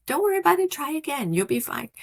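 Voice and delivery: put-on bright, high reassurance to a child; reassuring a child. Shown as a demonstration. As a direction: cheer up a discouraged little kid. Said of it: "you talk to them".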